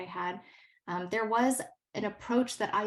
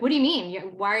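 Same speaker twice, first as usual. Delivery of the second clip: imitating a confused student; 8 dB louder than usual. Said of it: "she was so confused".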